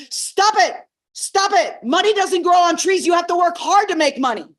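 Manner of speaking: loud, high, angry mother's shout; angry snap. Retold or imitated. Mother snaps angrily at her daughter over the bicycle. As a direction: snap at the child to stop. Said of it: "in an angry tone and says".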